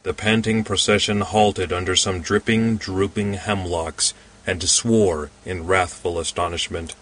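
usual speaking voice

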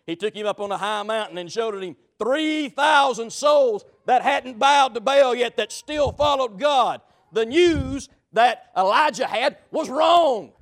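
loud, rising preacher's cry; preaching at full force. Acted. Preacher driving the point home to his congregation, loud and rising. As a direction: preach it out to the whole church.

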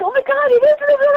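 excited squeal. Retold or imitated. Friend spotting the singer, squealing with excitement. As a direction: gush like a starstruck fan. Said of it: "So she's like".

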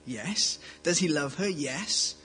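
ordinary talk

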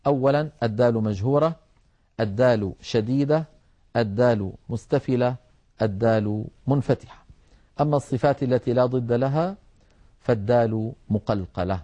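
ordinary talk

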